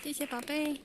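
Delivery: a soft, fond "thank you, sweetie"; tender thanks. Real, not acted. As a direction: thank the little one gently. Praising child after speech.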